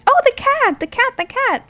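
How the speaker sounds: high thrilled cry at the cat; delighted squeal; excited squeal. Real, not acted. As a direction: gush over the cat, high and loud.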